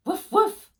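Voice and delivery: dog barking. A narrator's reading. Dog joins the cheering.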